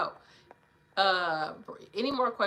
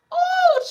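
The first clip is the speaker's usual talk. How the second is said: exasperated oh; 15 dB louder than usual. Real, not acted. Overwhelmed by sad topic.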